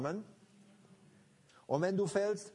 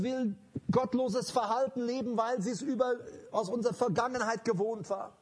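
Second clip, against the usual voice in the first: loud, driving sermon delivery; forceful preaching; 6 semitones higher, 4 dB louder than usual. Acted. Preacher exhorting the church, full force. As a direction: preach it hard to the congregation.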